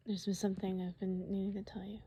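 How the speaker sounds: quick nervous whisper; fast whisper. Acted. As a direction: rush the confession in a whisper. Rushed whispered confession.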